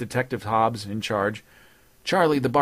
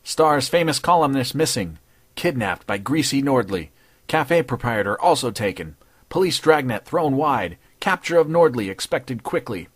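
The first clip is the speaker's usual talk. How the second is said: headline read; 4 semitones higher, 4 dB louder than usual. Acted. Reading headlines aloud.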